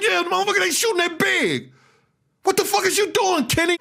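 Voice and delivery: high, frantic retold yell at the driver; screaming at Kenny in a panic; high panicked shout during the shooting.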